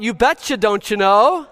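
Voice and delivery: high, loud Minnesotan sing-out; Minnesotan accent. Acted. Preacher quizzing congregation on local speech, hammed-up Minnesotan accent. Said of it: "A Minnesotan".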